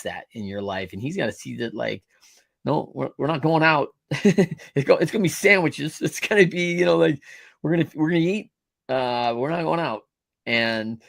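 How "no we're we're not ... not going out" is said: hammed-up voice of a frugal father; mock parent voice. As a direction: play the penny-pinching parent refusing a night out. Mimicking a frugal older parent.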